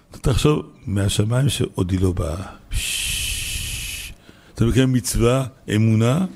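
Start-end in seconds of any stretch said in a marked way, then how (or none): 2.7-4.1 slow, soft hiss of air; awed "pshhh". Real, not acted. Preacher marvelling that it is all from Heaven, hissing exhale. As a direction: let a long voiceless breath hiss out. Wordless sound of awe.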